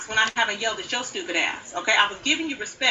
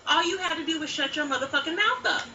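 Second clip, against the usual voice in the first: ordinary talk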